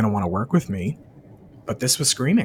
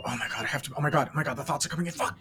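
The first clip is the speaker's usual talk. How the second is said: much softer, fretful mock panic; hushed inner panic of a restless meditator; mock anxious mutter; 8 dB quieter, faster than usual. Imitating anxious thoughts during meditation, softly panicked.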